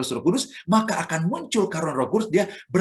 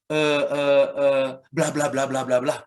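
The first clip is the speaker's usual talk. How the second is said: stammering nonsense syllables, half-hearted; mock babbling; slower than usual. Shown as a demonstration. Preacher showing a beginner's tongues, babbling. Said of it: "Ada yang mungkin gini".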